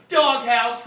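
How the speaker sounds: sudden loud "Doghouse"; loud exclaim. Acted. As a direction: blurt it out loud. Character repeats the word.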